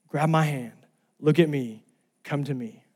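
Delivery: soft, tender invitation; gentle plea. Retold or imitated. Lifeguard getting all his attention, gentle soft appeal. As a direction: say it softly, letting it fade. Loving lifeguard's call, retold softly.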